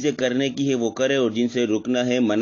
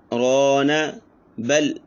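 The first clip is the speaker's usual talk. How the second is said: ordinary delivery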